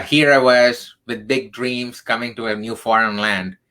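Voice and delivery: usual speaking voice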